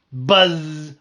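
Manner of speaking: very loud buzz; loud shout. Acted. Bee shouting buzz into a sleeper's ear. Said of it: "I shouted as loudly as I could".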